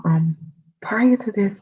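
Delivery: usual speaking voice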